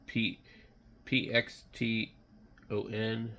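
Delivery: ordinary talk, nothing marked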